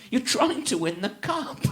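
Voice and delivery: ordinary talk, nothing marked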